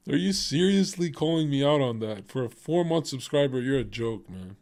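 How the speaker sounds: ordinary delivery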